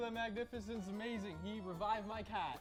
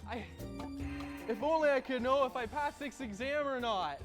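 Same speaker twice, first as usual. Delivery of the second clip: higher, worried student voice; anxious student; 6 semitones higher, 4 dB quieter than usual. Acted. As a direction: fret about the exam. Stressed student in ad.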